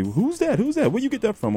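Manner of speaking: high-pitched, eager questions; high curious voice. Retold or imitated. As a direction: ask it high like a curious fan. Fans asking who sang it.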